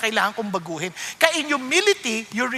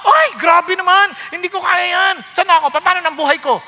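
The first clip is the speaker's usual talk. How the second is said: loud, raised-pitch protest; mock angry objection; 5 semitones higher, 5 dB louder than usual. Imitating a listener told to cut it off, indignant. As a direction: balk loudly at a hard command. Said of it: "Nagagalit ka agad".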